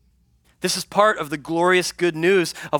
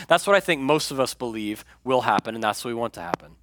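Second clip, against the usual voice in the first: normal talking voice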